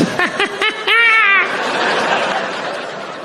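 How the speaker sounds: very high, prolonged mock cackle; exaggerated high-pitched fake laugh; imitated laughter. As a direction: mimic an audience laughing wildly.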